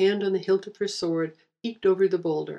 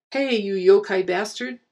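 taunting yell; 2 semitones higher, 5 dB louder than usual. Acted. Taunting the Oni to lure him.

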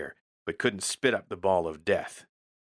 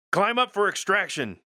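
much higher, loud shouted command; shouted order; 12 semitones higher, 5 dB louder than usual. Acted. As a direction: yell it over the engines. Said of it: "I shouted".

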